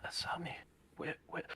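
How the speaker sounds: barely voiced, half-asleep whisper; groggy whisper. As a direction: whisper the name as if just waking. Akari waking, calling out weakly.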